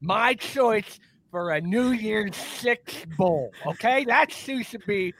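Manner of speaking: slow, emphatic put-on coach voice; Lou Holtz. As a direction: grandly praise the team in a comic coach's voice. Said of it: "that Lou Holtz praising James Madison".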